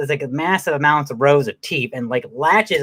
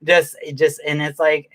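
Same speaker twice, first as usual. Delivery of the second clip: normal talking voice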